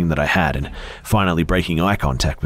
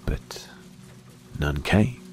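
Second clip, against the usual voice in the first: usual speaking voice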